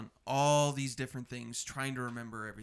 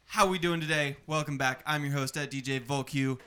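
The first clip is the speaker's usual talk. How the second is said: ringmaster; 2 semitones higher, 7 dB louder than usual. Acted. Host intro announcement.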